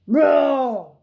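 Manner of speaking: big loud hammed-up roar; T-Rex roar. T-Rex roaring in his letter to Max, loud. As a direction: roar like a huge dinosaur.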